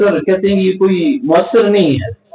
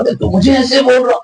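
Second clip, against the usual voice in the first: high, indignant protest from the retold quarrel; a touch of mock outrage at the insult; offended protest; 8 semitones higher than usual. Hakeem protests to the maulvi, in high mock indignation. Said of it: "اس کو غصہ آ رہا".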